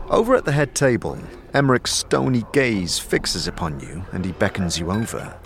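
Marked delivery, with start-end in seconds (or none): none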